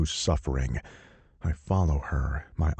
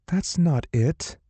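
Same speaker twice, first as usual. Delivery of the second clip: higher, slow, shocked woman's voice; gasping heroine; 11 semitones higher than usual. Acted. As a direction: gasp it out, hurt. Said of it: "she gasps".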